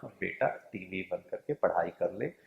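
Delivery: put-on a mother softly coaxing her child to study; mother gently urging son to switch off TV, soft and loving; lovingly. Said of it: "बहुत प्यार से उसको कहा".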